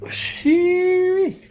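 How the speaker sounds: high, loud whoop of relief; relieved whoop. Real, not acted. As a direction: let out a big relieved whoop. Relief after freeing stuck part.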